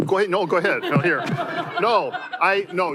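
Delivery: laughing while flustered; embarrassed laugh through words. Real, not acted. Flustered, handing over the mic.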